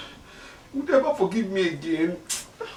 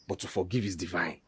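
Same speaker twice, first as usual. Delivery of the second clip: normal talking voice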